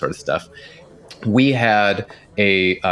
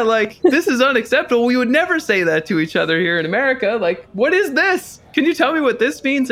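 excited retelling. Retold or imitated. Retelling his reply to the boss.

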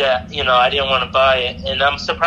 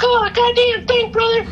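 high wrestler-guy voice. Retold or imitated. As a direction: ham up the vendor like a hyped-up wrestler calling you brother. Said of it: "in my best impression of him".

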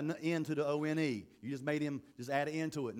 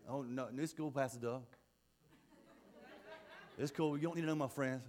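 put-on low, soft teenage brush-off; evasive kid; 5 semitones lower, 8 dB quieter than usual. Youth brushing off the pastor's question about friends, evasive. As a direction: play it cool and mumbly-soft, dodging.

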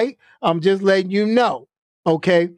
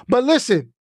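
ordinary talk

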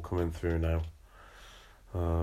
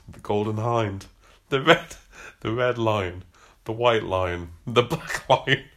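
louder, giggling through the names; laughing; 3 semitones higher, 7 dB louder than usual. Real, not acted. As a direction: reader barely holding it together.

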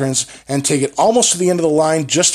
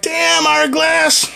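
stunned; 5 dB louder than usual. Retold or imitated. Reacting to the rant.

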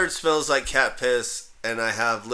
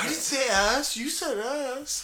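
much higher, giggly denial; laughing mock protest; 11 semitones higher, 5 dB quieter than usual. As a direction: tease back through laughter. Bickering in the coyote skit.

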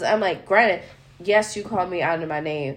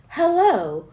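high, polite phone greeting; professional phone voice; 5 semitones higher than usual. Retold or imitated. Answering an unknown number, high polite phone voice. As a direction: answer like a customer service rep.

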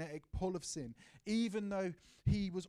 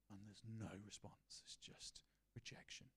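much softer, near whisper; hushed; 7 semitones lower, 14 dB quieter than usual. A narrator's reading. Preacher describing God's silence, hushed. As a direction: let it fade into silence.

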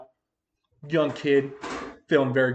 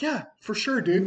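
sheepish; 6 semitones higher than usual. Retold or imitated. Caught by cop, sheepish reply.

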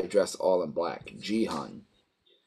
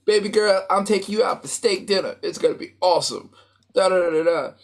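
high, loud, gleeful bragging; giddy boasting; 9 semitones higher, 3 dB louder, faster than usual. Retold or imitated. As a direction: crow about the win like a big shot. He gloats about winning money.

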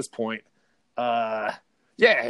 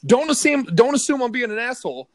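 much higher and louder, venting; heated outburst; 10 semitones higher, 7 dB louder than usual. Real, not acted. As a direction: blurt it out, fired up and offended. Venting at the TSA guy.

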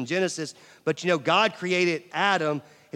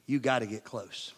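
hushed; 6 semitones lower, 6 dB quieter than usual. Shown as a demonstration. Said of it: "In order to hear a whisper".

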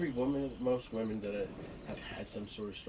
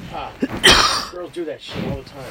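a loud laughing scoff at the trouble; loud scoffing grandfather; 14 dB louder, much slower than usual. Retold or imitated. Grandfather dismissing the boy's worry with a loud scoff. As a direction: bark it out, brushing the whole thing off. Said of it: "He's like".

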